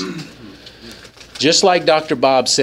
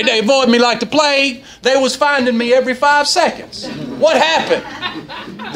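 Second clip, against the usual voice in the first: high amused disbelief; 9 semitones higher than usual. Acted. Comic bit about cops.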